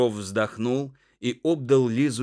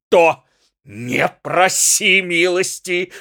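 loud, higher furious threats; threatening husband; 5 semitones higher, 6 dB louder than usual. A narrator's reading. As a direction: rage at the wife, shouting her down. Муж угрожает жене. (гневные угрозы в крик)

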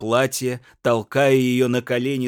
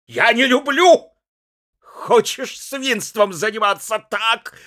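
much higher and louder hectoring shout; drunken tirade; 10 semitones higher, 8 dB louder than usual. A narrator's reading. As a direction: lecture her at the top of your voice. Пьяный муж отчитывает жену. (пьяная гневная отповедь)

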